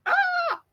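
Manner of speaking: short, high-pitched startled shriek; mock-panicked little scream; startled squeal. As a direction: let out a sudden high yelp. Imagined reaction to being recognized.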